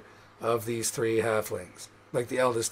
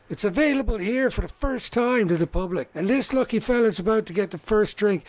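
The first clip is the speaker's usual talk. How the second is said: higher-pitched, proud announcer's patter; halfling showman; 7 semitones higher, 4 dB louder than usual. Acted. Halfling announcing his new stout to the tavern, proud showman. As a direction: work the tavern crowd like a salesman.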